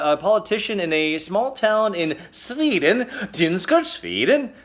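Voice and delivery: mock Swedish accent, rising at each word's end; lightly hammed-up Swedish accent with a lilting rise. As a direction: put on a playful Swedish accent for the town name. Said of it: "It's my Swedish accent".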